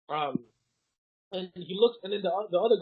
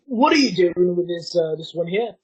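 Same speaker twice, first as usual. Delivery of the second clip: loud guard's challenge; guard loudly questioning a colleague; demanding guard; 3 semitones higher, 10 dB louder than usual. Acted. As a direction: demand an explanation, voice raised.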